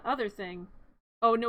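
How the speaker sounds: ordinary talk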